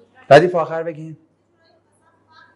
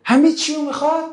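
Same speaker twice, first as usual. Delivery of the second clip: higher, put-upon voice of ordinary people; raised-pitch mock grumble of the masses; complaining common folk; 9 semitones higher than usual. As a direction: grumble like townsfolk whose life is being disturbed.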